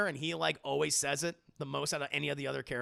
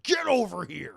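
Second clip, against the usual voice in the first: loud, drawn-out catchphrase; Scorpion; 4 semitones higher, 6 dB louder than usual. Retold or imitated. Streamer quoting a fighting-game line to chat, slow loud impression. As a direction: stretch out the fighter's taunt.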